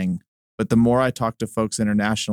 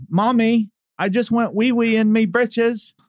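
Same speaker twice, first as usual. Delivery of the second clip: much higher, slow mock British little-kid voice; 13 semitones higher, slower than usual. Host mimics a British kid confessing to mom in British accent. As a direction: ham up a posh British child's accent, squeaky and drawn out. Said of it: "a little British kid will say".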